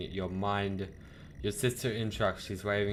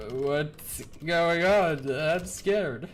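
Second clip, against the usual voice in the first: higher, louder, drawn-out whine; hammed-up frightened pleading; mock scared; 7 semitones higher, 4 dB louder, much slower than usual. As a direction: play up being scared, slowly. Reacts to bloodied Jen in game.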